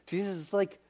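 ordinary talk, nothing marked

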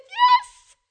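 very high, loud squeal; jubilant yes. Acted. God granting the mother's request, jubilant high shout. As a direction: burst out in mock-triumphant delight. Acts out God's joyful answer.

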